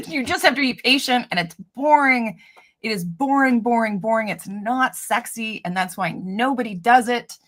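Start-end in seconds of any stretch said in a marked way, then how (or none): none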